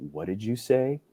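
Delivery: hushed, stunned question. Retold or imitated. Young soldier floored by the warrant officer news, stunned and hushed. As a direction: ask it softly, frozen in disbelief. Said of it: "I froze".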